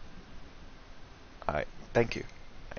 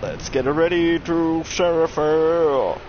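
slow booming ring announcer; 5 semitones higher, 5 dB louder, slower than usual. Acted. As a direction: stretch it like a fight intro. Announcer-style callout.

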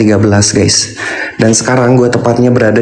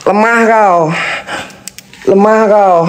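scornful taunt; 9 semitones higher, slower than usual. Real, not acted. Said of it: "Nggak suka gua marahin".

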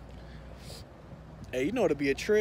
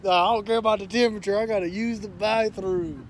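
fast, louder, desperate rush; frantic mock plea; 4 semitones higher, 6 dB louder than usual. Pretend desperate guy rushing to the bathroom, fast and loud. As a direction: blurt it out in a panic. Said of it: "Be like".

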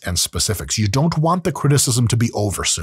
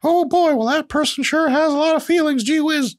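put-on high, chipper gee-whiz kid voice, much higher; a wholesome 1950s kid, bright and cheery. Brushing off mean criticism as a cheery fifties kid. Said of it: "Pretend you're like a kid in the nineteen fifties".

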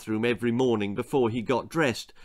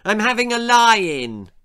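high, loud defiant shout; 9 semitones higher, 8 dB louder than usual. A narrator's reading. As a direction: yell it back from bed. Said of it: "shouted Simon".

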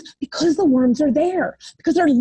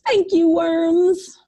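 put-on little girl; 4 semitones higher, much slower than usual. A narrator's reading. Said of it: "our little girl says".

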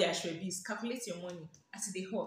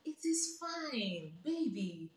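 mock soothing; 3 semitones higher, much slower than usual.

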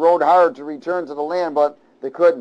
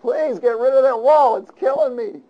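high, loud comic plea; begging sailor; 9 semitones higher, 4 dB louder than usual. Acted. Preacher as sailor begging Jonah, high comic plea. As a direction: beg him desperately, voice cracking upward.

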